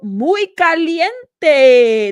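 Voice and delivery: loud, very drawn-out playful quote; hammed-up exclamation. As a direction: belt it out slowly, stretching the last syllable. Reading out a chat quote, hammed up.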